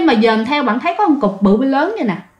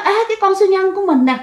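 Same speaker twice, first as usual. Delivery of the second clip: high, quick delighted exclamation; reo lên mừng rỡ; 8 semitones higher, much faster than usual. Retold or imitated. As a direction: imitate a kid happily spotting a lost toy. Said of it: "Thì bạn mừng quá". (reo lên mừng rỡ, giọng cao và nhanh)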